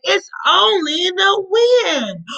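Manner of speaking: slow, high, drawn-out reply with a cry in it; a big mouse brushing his little brother off; sleepy big brother mouse. Acted. Big mouse tells little mouse, slow and drawn out.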